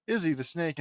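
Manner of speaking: ordinary talk, nothing marked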